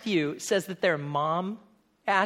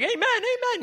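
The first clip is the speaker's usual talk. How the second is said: much higher, glib eager amens; mock eager amen. Churchgoers agreeing without understanding, high and glib. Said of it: "We're like".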